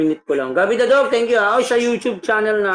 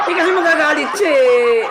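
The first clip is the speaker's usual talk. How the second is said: high, loud and amused; laughing explanation; 9 semitones higher, 4 dB louder than usual. Real, not acted. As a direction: protest through laughter, pitch raised. Laughs about friend getting angry.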